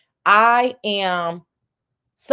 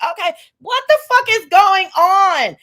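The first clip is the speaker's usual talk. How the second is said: loud, pitched-up fake outrage; hammed-up shout of disbelief; mock shout; 8 semitones higher, 5 dB louder than usual. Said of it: "I'm like".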